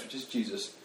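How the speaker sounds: ordinary delivery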